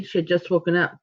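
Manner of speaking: plain reading voice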